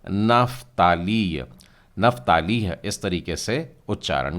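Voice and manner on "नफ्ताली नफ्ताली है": नफ्ताली said slowly, the Hebrew way, f sound clear; Hebrew pronunciation. Shown as a demonstration. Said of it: "इसे आप इब्री उच्चारण में". (इब्री उच्चारण में नफ्ताली)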